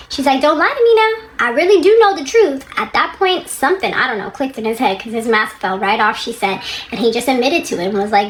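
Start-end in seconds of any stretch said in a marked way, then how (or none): 0.4-2.6 high, forceful imitation of a wife's warning; acted confrontation. Wife confronting her lying husband, acted insistent. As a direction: act her demanding the truth, pitched way up.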